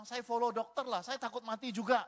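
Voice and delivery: loud and high, comically panicked; alarmed reply. Retold or imitated. Frightened patient giving in to shouting doctor, loud and high. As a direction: blurt it high and loud, matching the doctor's pitch. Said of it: "Karena dokternya ngomongnya gitu".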